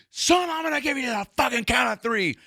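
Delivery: very high strained mock cop yell; sudden rage. Cop giving the kid a countdown, sudden mock rage. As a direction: imitate a cop exploding from zero to sixty. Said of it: "just goes from zero to sixty".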